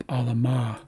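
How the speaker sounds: alama as pa-la-MA; last-syllable stress. Shown as a demonstration. Teacher compares it with the suffixed word, stress on last syllable. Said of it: "tells us to emphasize the last syllable".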